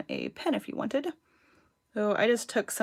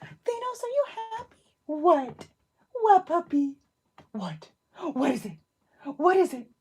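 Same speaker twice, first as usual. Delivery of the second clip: excited high voice winding up the dog; 8 semitones higher than usual. Real, not acted. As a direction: coo and tease the puppy, pitched way up. Baby-talking to her puppy.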